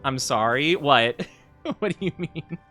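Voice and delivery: ordinary delivery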